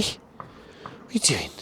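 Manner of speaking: slow whispered question; slow curious whisper. Real, not acted. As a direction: hush it to the dog. Whispering at dog.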